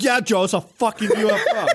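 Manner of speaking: high, incredulous rant at a clueless friend; exasperated Paul. Pretend. Paul answers Joe's doubt about the UFO, exasperated. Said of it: "And Paul would be like".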